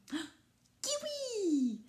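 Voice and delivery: very high held-out answer; eager child. A narrator's reading. Rikili answering papa, very high and happy. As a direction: blurt out the answer happily. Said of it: "répond Rikili".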